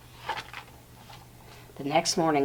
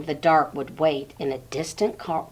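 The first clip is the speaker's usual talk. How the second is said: plain reading voice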